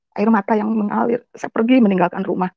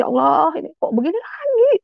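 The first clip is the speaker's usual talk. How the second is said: tearful plea; 7 semitones higher than usual. Retold or imitated. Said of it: "Saya nangis".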